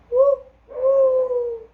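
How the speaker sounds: high owl hoot. Acted. Owl hoots.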